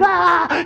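high-pitched terrified scream; hammed-up shriek of panic. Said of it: "He's screaming".

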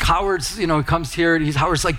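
ordinary talk

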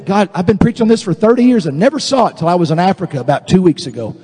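ordinary delivery

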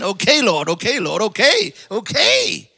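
reluctant "okay, Lord" that swells up then settles; grudging surrender. Retold or imitated. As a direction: give in to God grudgingly. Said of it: "I'm just like".

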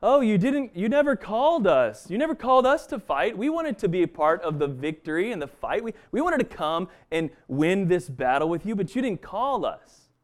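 whiny Ephraimites. Retold or imitated. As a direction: play the left-out brother whining. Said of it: "coming out saying".

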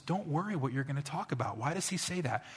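ordinary talk, nothing marked

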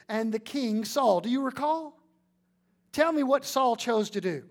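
loud, high, driving sermon voice; full-force preaching. Acted. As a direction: preach it out to the congregation.

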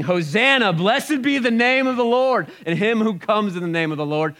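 high, loud cries of acclaim; crowd yelling. Retold or imitated. Preacher voicing crowds hailing Jesus, high loud yelling. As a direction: shout it like an exultant crowd. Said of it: "whole crowds yelling".

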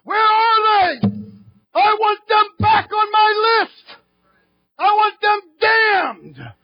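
high, slow, shouted devil voice; devil's demand. Acted. The enemy shouting over his roll call, furious. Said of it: "He looks at his roll call and he goes".

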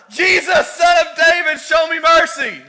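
very high, very loud desperate scream; full scream. A narrator's reading. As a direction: scream it with everything you have. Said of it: "screamed out even louder".

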